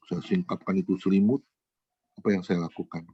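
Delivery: ordinary talk, nothing marked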